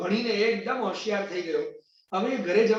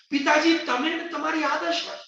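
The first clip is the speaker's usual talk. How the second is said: higher, louder cry of shock; shocked recognition; 6 semitones higher, 3 dB louder than usual. Acted. Son recognizing his ragged father, shocked and louder. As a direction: gasp it out as you recognize him.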